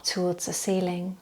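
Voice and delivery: calm meditation voice with long, unhurried pauses; settled, even yoga-teacher relaxation voice; guided body scan relaxation. Acted. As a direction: gently guide them into stillness, leaving space to breathe.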